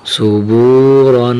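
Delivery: صبورن with a full, heavy ر sound, drawn out slowly. Shown as a demonstration. Teacher reading صبورن with a heavy ر. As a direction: make the ر in صبورن thick. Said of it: "یہاں حرف را کو موٹا کریں گے".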